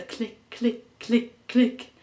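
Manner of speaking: crisp, evenly spaced clicks; imitated clock clicks. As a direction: mimic a ticking clock with the word.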